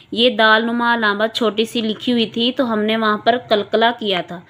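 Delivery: ordinary talk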